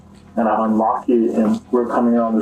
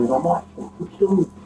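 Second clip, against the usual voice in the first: retold screamed threat; 7 semitones higher than usual. Survivor retelling the gunman's threat to the brother, muted imitated scream. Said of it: "he's screaming at me".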